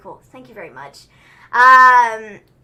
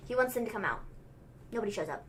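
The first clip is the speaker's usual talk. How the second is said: normal talking voice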